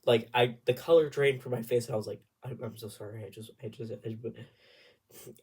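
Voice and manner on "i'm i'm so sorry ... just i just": hushed, faltering re-enacted apology; stammered apology. Singer drained of colour apologising, soft stammer. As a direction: stumble softly through an apology.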